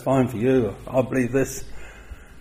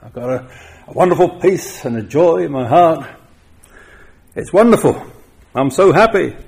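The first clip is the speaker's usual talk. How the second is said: bright, gushing mock churchgoer, pitched higher and louder; gushing happy believer; 6 semitones higher, 3 dB louder than usual. Preacher mimics a congregant gushing about feeling happy. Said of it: "And you're saying".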